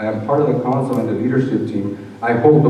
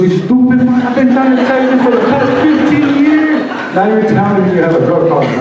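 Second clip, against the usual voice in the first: very high, loud shrieking mother's outburst; outraged mom; 14 semitones higher, 8 dB louder than usual. Retold or imitated. Mother reacting to son's drug confession, high loud outburst. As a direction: shriek it like a mother fed up for years.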